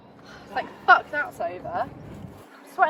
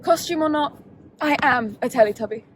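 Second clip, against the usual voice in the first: normal talking voice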